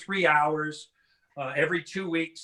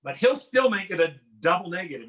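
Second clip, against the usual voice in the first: ordinary talk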